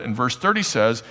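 ordinary delivery

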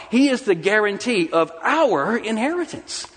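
high, emphatic "our"; stress on OUR. Shown as a demonstration. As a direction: preach it high, driving the weight onto "our". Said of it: "Do you hear the difference?".

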